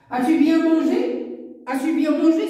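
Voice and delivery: teacher modelling a question with a rise on "mangé"; question intonation. Shown as a demonstration. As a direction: ask it clearly, lifting at "mangé". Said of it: "C'est une phrase interrogative".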